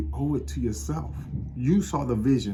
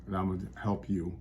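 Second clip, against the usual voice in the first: normal talking voice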